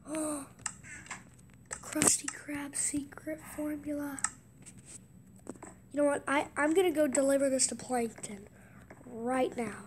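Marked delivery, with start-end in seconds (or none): 0.1-4.2 hushed, awed whisper. Real, not acted. Gamer finding the secret formula, whispering in awe. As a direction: breathe it out in awe.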